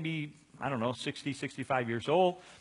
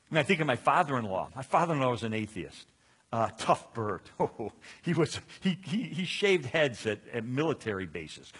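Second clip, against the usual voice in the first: normal talking voice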